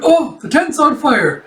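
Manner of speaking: high, slow alarmed soldier; alarmed cry. Acted. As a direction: yell out the alarm. Soldier sees fire.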